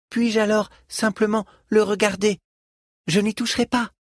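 put-on high-pitched pleading child; very high, earnest little voice; eager child. A narrator's reading. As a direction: ask like a child promising to behave. Child begs to see flute.

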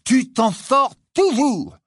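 very high, loud cheer; jubilant, drawn-out yell; triumphant cry. A narrator's reading. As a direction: shout it out in triumph, stretching it. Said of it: "s'écria Ron en donnant un coup de poing".

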